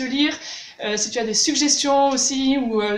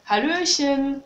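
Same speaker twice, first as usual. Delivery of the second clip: Baden dialect greeting; much slower than usual. Shown as a demonstration. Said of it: "si tu vas dans la région de Baden".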